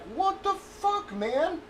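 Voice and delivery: high, slow, drawn-out what the fuck; stunned disbelief, pitch leaping up; incredulous outburst. Retold or imitated. Retold reaction to being slammed.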